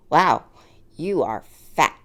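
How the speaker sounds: loud "Wow", then slow "you are fat"; exaggerated harsh verdict. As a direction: hammer it home as the cruel version she heard. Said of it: "But I heard".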